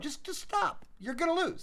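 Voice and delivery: high, mocking villain taunt. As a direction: sneer it down at a beaten hero. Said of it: "and you're like".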